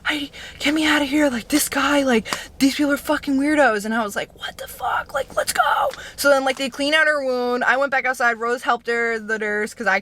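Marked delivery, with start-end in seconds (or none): 0.0-3.8 high, fast, panicked pleading; imitating a scared friend begging to leave; crying, freaking out. Injured friend begging Heidi to get her out, panicked and high. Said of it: "crying, and she's like". 4.4-5.9 whisper. Retold or imitated. Shocked reply to friend's plea.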